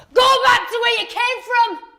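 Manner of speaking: loud, high yell; shout. Acted. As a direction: shout it hard from a passing van. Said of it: "and shout".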